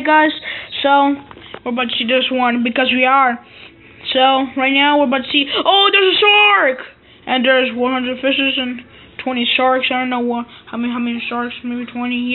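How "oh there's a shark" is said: high excited cry at the shark; sudden alarm, pitch leaps up; surprised. Real, not acted. Kid spots a shark on screen, excited cry.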